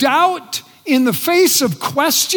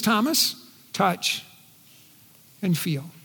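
tender invitation; 5 semitones lower, 8 dB quieter than usual. Acted. Jesus' invitation to Thomas.

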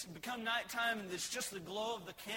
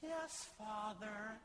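put-on obedient child; 6 dB quieter, much slower than usual. Obedient child answers parent.

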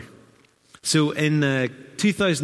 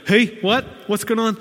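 high, louder mock alarm; acted startled confusion; 10 semitones higher, 4 dB louder than usual. Visitor suddenly addressed by a stranger in church, mock startled. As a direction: play up being caught off guard. Acts out his startled reaction.

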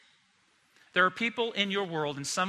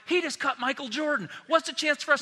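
panicked disciples; 9 semitones higher than usual. Retold or imitated. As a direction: squeal it like a frightened follower. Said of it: "They're like".

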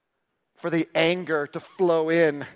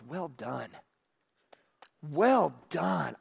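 much softer, tender praise; gentle voice of God; 6 dB quieter, much slower than usual. Acted. God commending the faithful steward, soft and tender. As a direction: bless them quietly.

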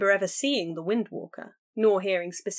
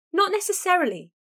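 higher, quick sister's denial; rushed; 10 semitones higher than usual. A narrator's reading. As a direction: blurt it out quickly. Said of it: "Kiana spoke in a rush".

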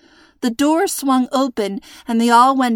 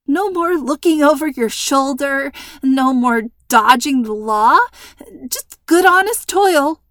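rising higher and louder, rushed nervous pitch; nervous pleading; 5 semitones higher, 5 dB louder than usual. A narrator's reading. Hostage pleading with her captor, voice climbing higher. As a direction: talk the kidnapper round, voice climbing. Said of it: "Tremble made her voice breathy and weak".

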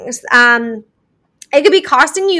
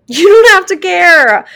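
louder and higher, slow, leaning on "don't"; emphatic reassurance; 6 semitones higher, 7 dB louder, slower than usual. Real, not acted. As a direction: say "don't" with full weight, slowly.

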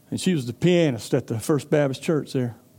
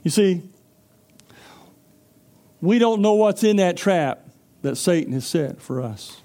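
normal talking voice

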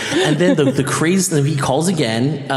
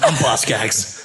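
imitating Boz; 4 semitones lower, slower than usual. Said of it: "like, walks with an accent".

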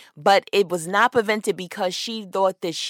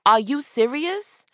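normal talking voice

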